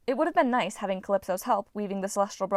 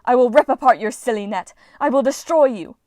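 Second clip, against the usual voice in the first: loud, furious goddess threat; enraged vow of destruction; raging threats; 4 semitones higher, 10 dB louder than usual. A narrator's reading. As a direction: roar it at the mortals.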